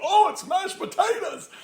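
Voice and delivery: very high, squeaky knowing exclamation; mock exclamation. Listeners in on the code word, high mock squeal. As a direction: squeal it as if catching on.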